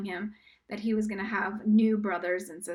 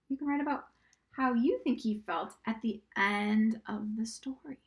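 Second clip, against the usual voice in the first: whisper. Acted. Teacher whispers writing directions to the students. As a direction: whisper the instructions gently.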